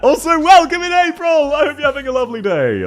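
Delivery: high-pitched, projected welcome; excited greeting. Real, not acted. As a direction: burst into a big hello for a newcomer. Streamer calling greeting to viewer.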